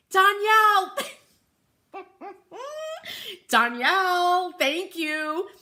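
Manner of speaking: high, loud delighted call of a name; light up and call out her name; real thrill at seeing her; excited call. Real, not acted. Greeting viewer by name.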